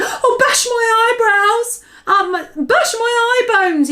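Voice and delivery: high, loud, slow tearful wail; distressed cry. Acted. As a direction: lament the nightmare. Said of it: "he cried".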